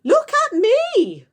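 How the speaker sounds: put-on very high, loud, slow old woman's yell; Grandma shouting. Grandma shouting from the roof to be noticed, very high. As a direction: shriek it down from the rooftop. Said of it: "Grandma shouted from the rooftop".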